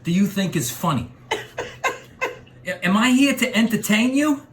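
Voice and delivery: menacing rising tough guy rant; Joe Pesci impression. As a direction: Joe Pesci gangster voice, getting angry. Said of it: "Joe Pesci".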